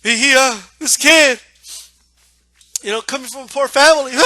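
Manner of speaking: hammed-up weepy sympathy, broken by pauses; mock crying. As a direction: imitate a commentator choking up. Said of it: "And he started to cry".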